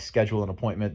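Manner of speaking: ordinary talk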